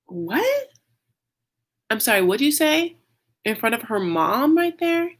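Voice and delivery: high-pitched, incredulous questioning; acted outraged astonishment; shocked disbelief. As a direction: squeak it out, scandalized. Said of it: "shocking to me".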